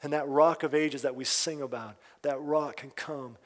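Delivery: hushed pastoral prayer; closing prayer. Acted. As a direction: pray quietly over the congregation.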